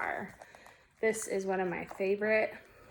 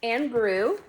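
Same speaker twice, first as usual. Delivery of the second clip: expressive picture-book narration, louder than chat; story read-aloud; 4 semitones higher, 11 dB louder than usual. A narrator's reading. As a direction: draw out the dramatic words for children. Reads picture book aloud to children.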